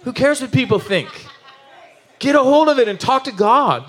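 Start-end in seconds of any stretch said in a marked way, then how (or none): 2.2-3.9 higher, punched preacher push; urgent exhortation. Real, not acted. Preacher urging the congregation to pray, raised and pressing. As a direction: drive it home to the church.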